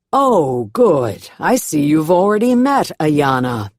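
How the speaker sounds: loud reverend calling across a stage. A narrator's reading. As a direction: raise your voice to be heard far off. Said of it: "has to raise his voice".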